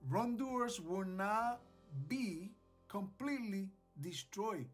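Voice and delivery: ordinary delivery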